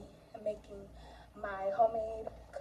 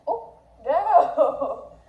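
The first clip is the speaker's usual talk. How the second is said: laughing exclamation; 5 semitones higher, 12 dB louder than usual. Real, not acted. Dog licking her fingers.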